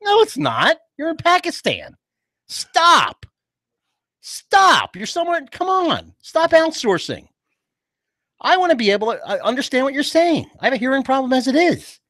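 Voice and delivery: high-pitched fed-up rant; exasperated. Acted. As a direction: cut the agent off, indignant. Irritated customer berates agent.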